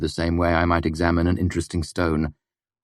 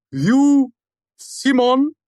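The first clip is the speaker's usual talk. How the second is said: slow, much higher and louder, a grand wizard proclaiming; resonant intoning; 7 dB louder, much slower than usual. Acted. Puppet greets visitors as Taborlin, grandly intoning. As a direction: intone it like a hammed-up legendary wizard. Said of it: "his voice resonant but slightly muted".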